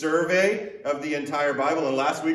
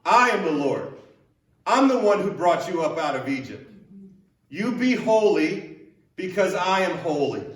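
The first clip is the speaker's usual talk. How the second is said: stern yet loving God; 3 semitones higher, 3 dB louder than usual. Retold or imitated. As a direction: boom it out like a stern, loving Lord. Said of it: "this stern yet loving reminder".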